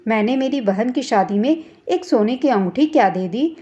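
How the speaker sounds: plain reading voice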